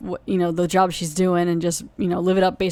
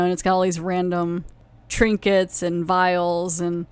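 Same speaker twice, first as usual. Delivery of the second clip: ordinary talk, nothing marked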